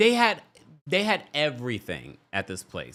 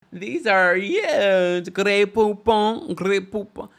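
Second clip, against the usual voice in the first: louder mock-posh Grey Poupon; hammed-up snooty rich lady; 5 semitones higher, 4 dB louder, much slower than usual. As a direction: ham up the new-money guest. Mocking Lisa again.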